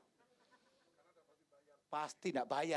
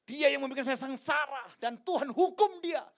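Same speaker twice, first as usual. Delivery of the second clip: mock angry eulogy; 8 semitones higher, 13 dB louder than usual. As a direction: thunder it like a furious funeral sermon. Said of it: "Saya bisa bilang ini". (khotbah marah pura-pura dengan suara keras)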